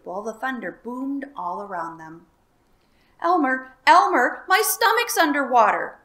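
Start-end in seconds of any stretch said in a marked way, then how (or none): 3.2-6.0 high, loud alarmed call; crying out in alarm. A narrator's reading. Dragon wakes Elmer in alarm, loud cry. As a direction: call him awake. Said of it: "cried the dragon".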